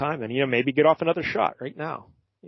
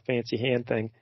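normal talking voice

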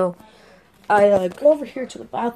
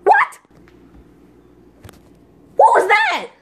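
sudden high, loud what; shrill startled gasp of a question; 4 dB louder than usual. Acted. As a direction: jump at a noise and cry out. Startled by the ghost voice.

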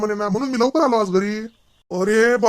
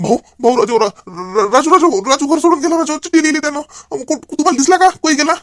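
panicked wail; 7 semitones higher, 4 dB louder than usual. Acted. Panicked news son ran away.

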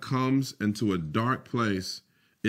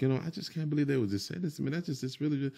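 softer, fast, higher self-talk; mock grumbling at home; 3 semitones higher, 7 dB quieter, much faster than usual. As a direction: grumble it quickly under your breath.

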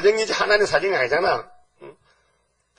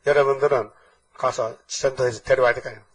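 usual speaking voice

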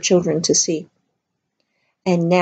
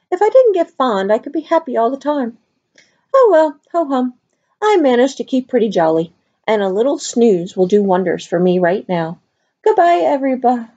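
jolly medium; 6 semitones higher than usual. Acted. The medium chirps goodbye to the children before dozing, high and giddy. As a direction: bubble along then drift off sleepy.

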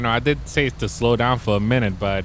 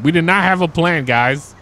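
worked up; 4 semitones higher, 7 dB louder than usual. Real, not acted. Panicked reaction mid mission.

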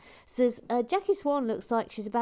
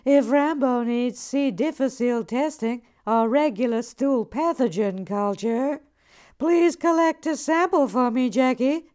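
loud comic reading; 6 semitones higher, 9 dB louder than usual. Acted. Host reads a cheeky viewer comment to Jackie aloud, hammed-up. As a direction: read it out loud and higher, hamming it up.

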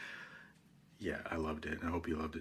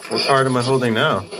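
loud, higher-pitched question; calling out; 6 semitones higher, 10 dB louder than usual. Real, not acted. Asking the spirits which card is held, calling out. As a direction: call it out to the room.

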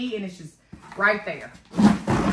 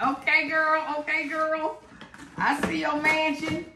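excited; 6 semitones higher than usual. Real, not acted. Excited over the mansion gift.

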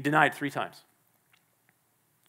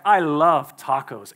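casual love; 5 dB louder, slower than usual. Shown as a demonstration. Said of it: "we say things like".

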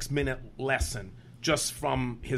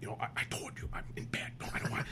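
very soft, whispery retold objection; hushed bedtime protest; 10 dB quieter, much faster than usual. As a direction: murmur it from the pillow, barely voiced. Woken in bed, declining a call.

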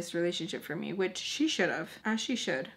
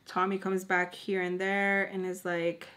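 ordinary talk, nothing marked

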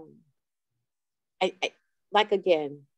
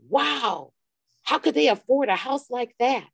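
higher-pitched, incredulous wow and question; imitated astonishment; 7 semitones higher, 3 dB louder than usual. Friend reacting to a photo of the cousins' house, astonished. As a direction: act out a friend's stunned disbelief.

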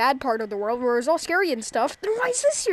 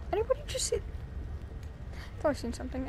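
spooked whisper; 3 semitones higher, 10 dB quieter than usual. Real, not acted. Spooked gamer whispering about a shadow. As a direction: breathe it like someone might hear.

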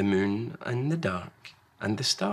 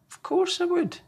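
higher, bright warm reply; Large, loving parent; 3 dB louder than usual. Acted. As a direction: answer without a doubt. Large answers Small.

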